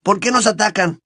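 high, aggrieved question; indignant question. A narrator's reading. Ron protesting the wizards' attack, indignant. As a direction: ask as the wronged one. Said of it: "con expresión indignada".

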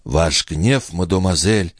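plain reading voice

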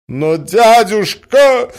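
loud, high, drawn-out protest; rash blurting nephew. A narrator's reading. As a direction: blurt it out in exasperation. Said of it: "брякнул Мозгляков в затмении ума своего". (выпалить громко, с досадой)

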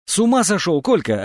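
high-pitched cry of protest dropping on the name; desperate yell. A narrator's reading. Анчутка protests from a safe distance, yelling desperately. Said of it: "отчаянно вопил Анчутка".

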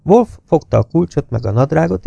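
ordinary delivery, nothing marked

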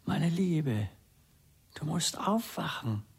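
soft, tender waking call. Acted. Husband softly calls his dead wife to wake. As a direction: coax her gently awake.

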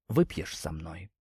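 low, soft, slow seductive offer; femme fatale. Acted. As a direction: draw him in slowly. Selia offers wine.

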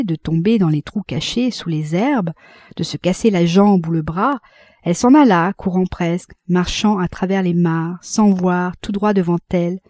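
plain reading voice

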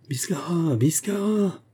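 high-pitched call of a name. A narrator's reading. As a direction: call for a missing companion. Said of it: "crièrent les voix plus rapprochées".

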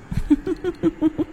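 shrill, high witch cackle; ghostly cackle. Acted. Ghost woman cackles at the boy, shrill and eerie. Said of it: "cười lên khanh khách".

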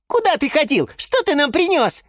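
very high, eager squeal; high-pitched childlike questioning; squeaking little ones. A narrator's reading. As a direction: pipe up like tiny seedlings. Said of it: "запищали малыши рассада".

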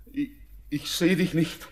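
building from high and anxious to very high, loud begging; desperate pleading. Acted. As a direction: beg her not to vanish, voice climbing.

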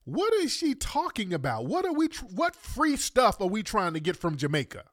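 high, disbelieving questions; can't believe what she said; incredulous. Real, not acted. Disbelief at clip.

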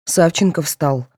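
ordinary delivery

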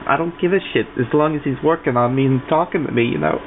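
ordinary talk

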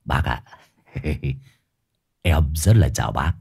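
low, laughing, slurred greeting; slurred drunk voice. A narrator's reading. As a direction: slur it with a giggle. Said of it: "giọng lè nhè đơ đớ".

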